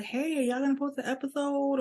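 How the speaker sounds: eager listener. Retold or imitated. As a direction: voice a fan nudging for the next one. Voicing listeners asking for episodes.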